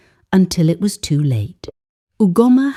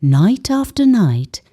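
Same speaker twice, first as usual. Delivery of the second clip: plain reading voice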